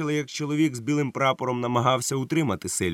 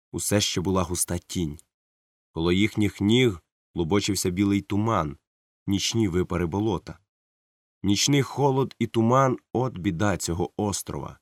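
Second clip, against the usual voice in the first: usual speaking voice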